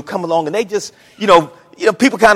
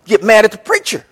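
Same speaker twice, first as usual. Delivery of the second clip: ordinary delivery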